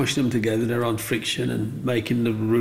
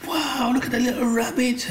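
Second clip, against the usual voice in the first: put-on excited kid; 13 semitones higher than usual. Child showing off the rabbit carving, excited high. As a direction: squeal it like a thrilled child. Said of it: "I was like".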